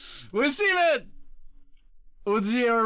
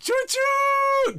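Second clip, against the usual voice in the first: very high, loud mock train whistle; train whistle imitation; 3 dB louder than usual. Streamer announcing the hype train with a high train toot.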